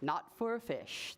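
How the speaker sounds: low, measured correction; calm, low-pitched tree; tree explaining. Acted. Tree explaining to a boy, low and measured.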